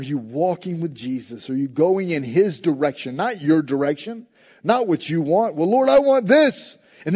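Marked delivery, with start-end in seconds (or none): 5.5-6.8 put-on high, loud childish whine; whiny child. Pastor mimicking a churchgoer demanding from God, childish whine. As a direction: whine like a spoiled little child. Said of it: "Like a little child".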